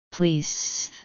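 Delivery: "Please" softly lisped, the s turned to th; quiet, lisping plea on "Please"; desperate lisp. A narrator's reading. Injured Jaraz pleading to stay, desperate lisp on "Please". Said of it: "Jaraz lisped desperately".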